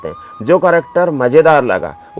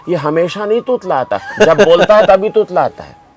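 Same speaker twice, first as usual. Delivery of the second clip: mock offended mother; 7 semitones higher than usual. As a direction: imitate an indignant mother, pitched higher. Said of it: "तो नाराज़ हो गई".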